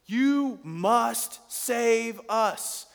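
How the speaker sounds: high, loud and very slow, every word dragged out; imitating the Gibeonites' urgent command, high and loud; urgent plea. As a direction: beg them to save you, stretched out. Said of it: "It's urgent".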